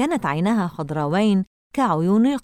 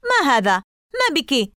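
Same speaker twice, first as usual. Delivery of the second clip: higher, sharp indignant exclamation; angry shout; 7 semitones higher than usual. A narrator's reading. Boy nearly knocked over by a punch, mildly shouted anger. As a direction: read an angry outburst without full shouting. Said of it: "صاح ويل بغضب".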